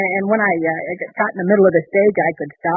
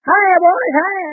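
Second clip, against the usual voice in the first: higher, hammed-up champ waving to the crowd; mock prize-fighter greeting; 11 semitones higher, much slower than usual. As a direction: play the winner greeting the fans. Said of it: "shaking hands with myself over my head like this".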